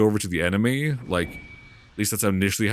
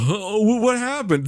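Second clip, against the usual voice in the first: high, hammed-up confusion; exaggerated baffled whine; mock bewilderment; 9 semitones higher than usual. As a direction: mimic a clueless heroine, pitched up. Mocking the heroine's confusion.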